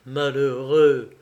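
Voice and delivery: indignant cry, drawn out slowly; choked indignation. A narrator's reading. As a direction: reproach him slowly and loudly, with indignation. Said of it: "s'écria Claire dont l'indignation étouffait la voix".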